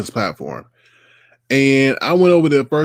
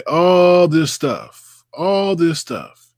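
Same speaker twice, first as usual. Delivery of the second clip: slow emphatic stress; 6 semitones higher, 4 dB louder, much slower than usual. Real, not acted. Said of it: "emphatic slowed repetition".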